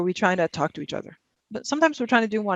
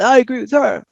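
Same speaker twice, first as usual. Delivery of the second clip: raised, louder mock shout; mock yell; 5 semitones higher, 7 dB louder than usual. Speaker imitating people yelling on social media, mock yell. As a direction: imitate yelling an opinion, pitched up. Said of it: "So I yell".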